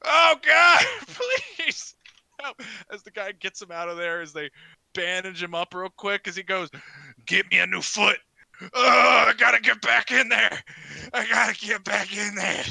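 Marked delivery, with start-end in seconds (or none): 0.0-2.6 high, hammed-up cry for help; desperate plea. Bitten man begs the crowd, crying for help. 7.2-12.6 raised, wailing acted shout; frantic insistence. Bandaged wrestler demands to return, wailing.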